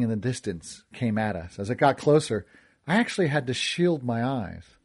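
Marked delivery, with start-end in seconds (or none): none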